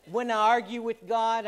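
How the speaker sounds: ordinary talk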